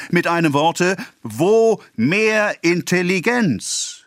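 a provincial councillor orating to the assembled farmers; declaiming official. A narrator's reading. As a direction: proclaim it like a speech to a crowd. Councillor's speech continues.

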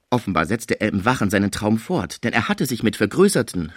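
plain reading voice

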